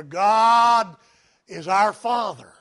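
usual speaking voice

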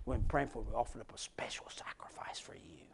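hushed, breathy mock piety; mock priest whisper. Priest visiting the sick man, mock-pious whisper. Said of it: "Even some of the priests come by and say".

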